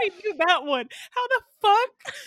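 high, loud, helplessly amused outburst; laughing disbelief. Retold or imitated. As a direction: cry out in disbelief while cracking up. Retold disbelief, cracking up.

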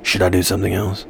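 low, soft, quick creepy voice. Pretend. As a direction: keep the low spooky voice, quickly. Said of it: "I really don't like the voice".

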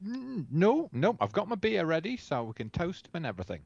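usual speaking voice

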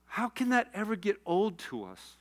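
subdued, high crying voice; real tearful question. Real, not acted. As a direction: ask it softly through tears. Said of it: "I'm crying talking about Irv".

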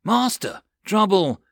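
yelled warning. Acted. Sailor alerting the master from the decks, yelling. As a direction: call out the alarm from deck. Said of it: "someone yelled from the decks".

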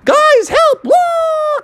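very high, mock-terrified yelp; high cartoon panic; panicked cry for help. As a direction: squeal for help as you sink. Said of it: "he was like".

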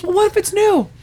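high-pitched anxious retort; fretful worry. Retold or imitated. Monica wonders about Richard's message, fretful worry. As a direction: imitate a friend working herself up.

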